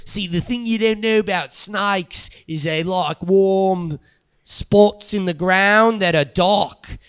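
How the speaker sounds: mock Aussie snake expert explaining, with pauses; broad Australian lecture, breaking up at the end; Australian accent. [name] explains where snakes like to hide, in a mock-Australian accent. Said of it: "You're good at accents".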